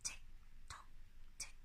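tiny clicked syllables, evenly spaced; ticking clock. Acted. Living room clock disturbs the bear, small even ticking. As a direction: tick softly and steadily. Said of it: "went the living room clock".

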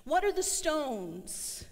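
ordinary talk, nothing marked

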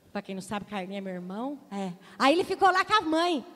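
ordinary talk, nothing marked

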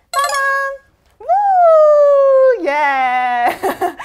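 high ta-da into a loud whoop; excited reveal. Real, not acted. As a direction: present it with a big cheer. Reveals the fifth quiz.

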